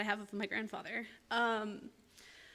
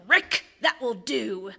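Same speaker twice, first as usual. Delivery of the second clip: slow, louder mock reprimand; playful scolding; 7 dB louder, much slower than usual. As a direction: tease him like a loving wife. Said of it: "in a loving, playful way".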